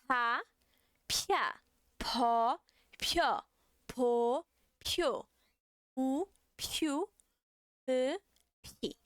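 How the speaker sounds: "pa" with a strong puff of air; syllables pushed out on a burst of breath; aspirated Korean p. Shown as a demonstration. Teacher drills aspirated P to class with plenty of air. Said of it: "Bastante ar aí".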